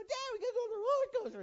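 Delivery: put-on high, fast eager child voice; kid begging. Son pleading with dad to ride, high eager child voice. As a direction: beg like an excited kid. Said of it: "'Cause, you know".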